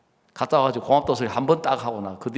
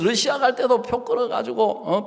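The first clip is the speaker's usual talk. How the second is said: ordinary delivery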